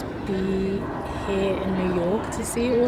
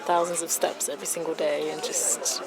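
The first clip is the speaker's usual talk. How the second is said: worn out and quiet; low energy, drained after long days; exhausted; 3 semitones lower, 6 dB quieter than usual. Real, not acted.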